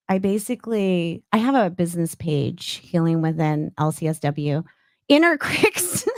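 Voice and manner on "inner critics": laughing. Real, not acted. Laughing while saying the phrase.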